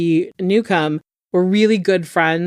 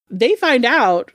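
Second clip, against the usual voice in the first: ordinary talk, nothing marked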